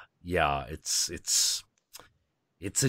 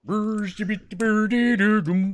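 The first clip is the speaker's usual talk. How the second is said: high, louder mock Swedish babble; Swedish Chef; 12 semitones higher, 4 dB louder than usual. Host joins in, doing the Swedish Chef, high and loud. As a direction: go full Muppet Swedish Chef gibberish, much higher. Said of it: "the Swedish chef from, uh, the Muppets".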